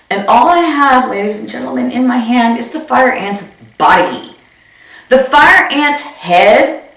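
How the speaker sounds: ordinary talk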